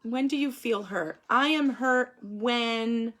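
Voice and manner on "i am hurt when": slow prompt. Shown as a demonstration. Teacher drawing out sentence starter.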